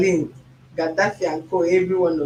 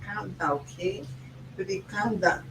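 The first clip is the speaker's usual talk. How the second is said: soothing; 8 dB quieter than usual. Pretend. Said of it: "I would say".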